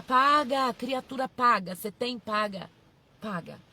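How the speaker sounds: loud, punchy order; loud, impatient command; exasperated scolding. Acted. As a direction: scold a dithering follower into acting. Scolds imagined member stalling on paying.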